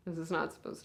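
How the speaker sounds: a big sister at a hospital bedside, swinging between hushed and raw; hushed, aching bedside monologue; grieving big sister. Acted. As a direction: speak to your unconscious little sister, holding back tears. Sister talks to comatose sibling.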